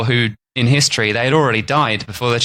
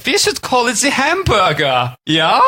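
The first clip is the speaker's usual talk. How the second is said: mock German butcher; 11 semitones higher than usual.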